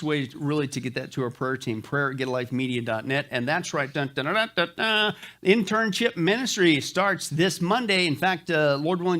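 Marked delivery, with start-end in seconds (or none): 3.9-5.1 hummed dramatic dun-dun build; playful mock fanfare rising at the end. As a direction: sing it like a cheesy drumroll. Dramatic fanfare before announcement.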